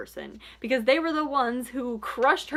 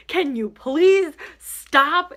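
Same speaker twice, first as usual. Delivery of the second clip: slow, louder, stretched please; exasperated outburst; 4 semitones higher, 6 dB louder, much slower than usual. Retold or imitated. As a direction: beg loudly, fed up. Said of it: "And I was just like".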